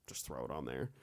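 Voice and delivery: low, very soft throwaway line; offhand mock producers. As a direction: imitate producers casually dropping it, low and quiet. Producers' careless decision, acted.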